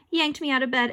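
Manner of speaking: ordinary delivery, nothing marked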